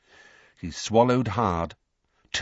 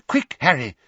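higher, louder hurried call; urgent; 5 semitones higher, 5 dB louder than usual. Acted. Weasley as lift doors open, urgent. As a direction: urge him to move quickly.

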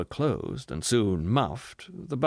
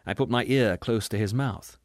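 ordinary delivery

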